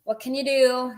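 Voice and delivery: ah, what can you do, said with a sigh; a resigned, what-can-you-do tone; resigned shrug. Shown as a demonstration. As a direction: give up with a shrug in the voice. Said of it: "say it with a certain intonation".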